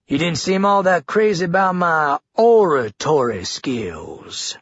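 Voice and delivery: slow, with oratory spelled out syllable by syllable; exaggerated oratory. A narrator's reading. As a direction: lean hard on each syllable of oratory. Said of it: "putting exaggerated enunciation on the word oratory".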